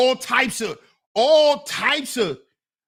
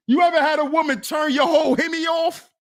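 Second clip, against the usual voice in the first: louder, faster shout of the question; shouted repeat; 6 semitones higher, faster than usual. Shown as a demonstration. Shouting the question again to Zuma, loud and fast. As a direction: yell it out to the room, quicker. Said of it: "repeat louder".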